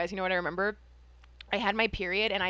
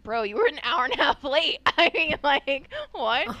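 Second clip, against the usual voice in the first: mock-outraged, louder comeback; indignant retort; 4 semitones higher, 9 dB louder than usual. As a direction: call out a latecomer in disbelief. Retold retort to latecomer.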